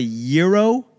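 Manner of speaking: "gyro" said as YEE-roh; "gyro" with a y sound at the start, rising; pronunciation variant. Shown as a demonstration. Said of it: "however the fuck you pronounce it".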